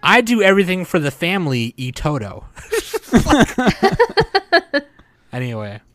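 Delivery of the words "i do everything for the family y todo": put-on Spanish tacked on in a thick English accent; hammed-up gringo Spanish; heavy English accent. Mocking English speakers saying Spanish words, thick English accent. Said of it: "super huge English accent".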